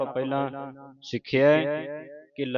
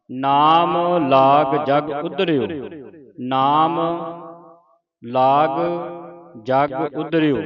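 loud, slow Gurbani recitation; scripture recitation; 8 dB louder, much slower than usual. Shown as a demonstration. Teacher reciting verse for students, loud measured chant. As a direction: ring out each word, drawn out.

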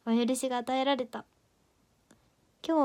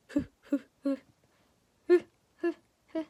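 mock laugh; 4 semitones higher, faster than usual. Mock villain laugh on stream.

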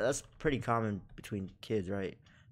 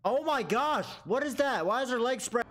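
scared exclaim; 10 semitones higher than usual. Real, not acted. Player spooked by a creepy figure, high alarmed voice. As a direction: shriek your alarm at the screen.

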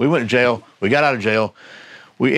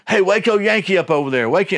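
mock yell from cells; 7 semitones higher than usual. Inmates taunting the new kid from their cells, mock yelling. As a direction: call out across the cells, half-hearted. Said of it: "People in the other jails are yelling".

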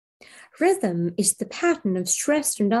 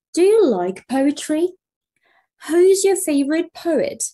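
higher, louder questions tossed to the class; teacher warming up students, bright and loud; eager questions; 6 semitones higher, 6 dB louder than usual. Shown as a demonstration. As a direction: spark the class with lively questions. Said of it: "teacher quizzes the class".

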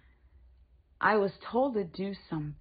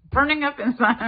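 hammed-up loud, higher cry; joking outburst, louder and higher; mock fury; 7 semitones higher, 12 dB louder, faster than usual. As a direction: play at boiling over with rage. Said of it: "No, just playing".

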